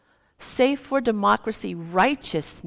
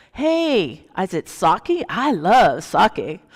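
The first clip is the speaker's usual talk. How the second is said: loud, delighted, slowing on the last words; lightly voiced happy old man; cheerful old man; 7 dB louder, slower than usual. Old man cheerfully tells the drunk he loves sake.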